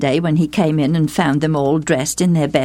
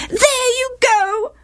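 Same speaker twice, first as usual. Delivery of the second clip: very high, drawn-out wail; slower than usual. A narrator's reading. Wife reproaching Gilead for siding with Jephthah, wailing. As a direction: wail it as an aggrieved wife, stretching the words. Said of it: "wailed Gilead's wife".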